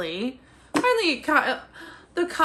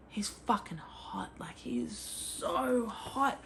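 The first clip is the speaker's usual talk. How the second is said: whisper; 8 dB quieter, slower than usual. Real, not acted. Gushing about the love interest.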